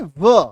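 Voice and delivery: a clear, loud व sound for WH. Shown as a demonstration. Teacher shows students WH is said as व, said loudly. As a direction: say व crisply, as a plain v/w sound. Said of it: "डब्ल्यूएच का उच्चारण क्या होता है".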